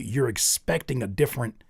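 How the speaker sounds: ordinary talk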